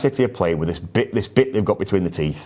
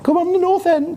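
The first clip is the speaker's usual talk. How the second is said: high excited chant; cheering. Real, not acted. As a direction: cheer them on like a fan. Cheering his team on.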